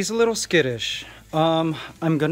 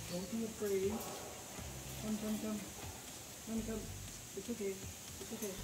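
very soft, higher-pitched pet talk; soothing coaxing; 6 semitones higher, 18 dB quieter, faster than usual. Real, not acted. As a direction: gently coax a nervous animal out. Coaxing nervous iguana out of cage.

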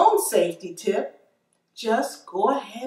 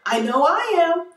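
excited; 9 semitones higher than usual. Acted. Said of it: "Are you excited?".

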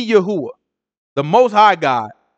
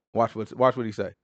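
usual speaking voice

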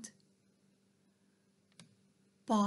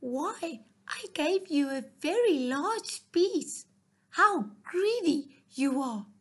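higher, louder indignant exclamation; exclaiming; 6 semitones higher, 4 dB louder than usual. Acted. Mouse scolding the greedy fox, higher and louder. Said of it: "exclaimed the mouse".

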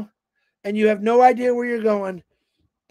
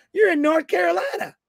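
put-on Southern accent; 7 semitones higher than usual. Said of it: "here comes that accent".

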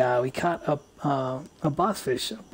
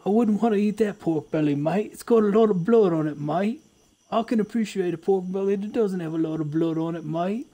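pitched-up mock Aussie, every line tagged with mate; Australian accent; 5 semitones higher than usual. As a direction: play a jokey Australian bushman. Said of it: "Accents are too easy".

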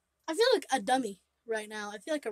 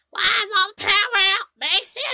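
high, loud mock scolding grandma; angry grandma; 8 semitones higher, 10 dB louder than usual. Grandma finds the power out, mock scolding yell. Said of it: "Grandma's gonna come in here and be like".

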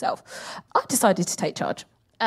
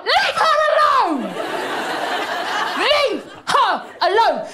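high, drawn-out yell; protective outcry; 12 semitones higher, slower than usual. Acted. Comic defending a former first lady, high drawn-out outcry. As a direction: wail it slowly, pitched way up, defending her. Defending Obama against calls to run.

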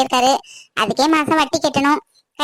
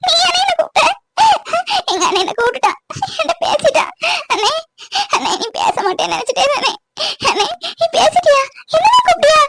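high, sobbing disbelief turning to pleading; tearful; 7 semitones higher than usual. Acted. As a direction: cry as you beg your brother's forgiveness. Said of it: "அழாத காவேரி". (அழுதுகொண்டே கெஞ்சும் குரல்)

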